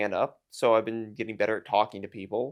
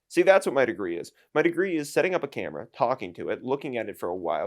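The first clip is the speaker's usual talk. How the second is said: ordinary delivery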